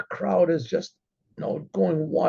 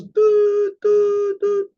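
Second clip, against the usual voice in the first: three very high horn-like doo notes; broken shofar blasts; 14 semitones higher, 4 dB louder, much slower than usual. Retold or imitated. Shlomo sounds shevarim at the sultan's party, three short blasts. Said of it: "And he goes".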